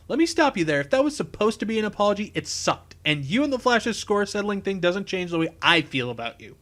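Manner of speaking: high, loud rebuke; indignant. Acted. Engineer rejects the criminal's poor apology, indignant. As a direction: cut in sharply and keep the pitch up. Cisco cuts Snart off angrily.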